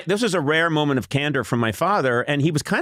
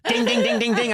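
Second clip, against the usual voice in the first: high sung ding-ding of a spoon on a cup; tap-tap dinging like a spoon; imitated spoon ding; 8 semitones higher than usual. Boy bangs a spoon on his cup. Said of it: "banging it on a cup".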